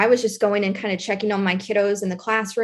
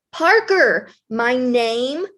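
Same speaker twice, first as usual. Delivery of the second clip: slow, emphatic name with pauses between; livid little boy; 3 dB louder, slower than usual. Retold or imitated. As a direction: imitate an exasperated kid, punching each name. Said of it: "he is livid at this point".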